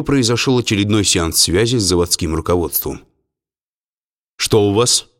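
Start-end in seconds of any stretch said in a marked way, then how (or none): 4.4-5.1 mildly stern official question; строгий голос. A narrator's reading. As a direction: ask with a touch of severity. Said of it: "строгим голосом вопросил нотариус". (слегка строго)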